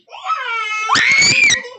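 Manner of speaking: excited cheer. Real, not acted.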